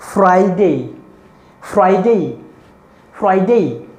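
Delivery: फ्राइडे said with a clear डे ending; teacher drilling फ्राइडे; model pronunciation. Shown as a demonstration. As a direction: repeat फ्राइडे crisply. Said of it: "next".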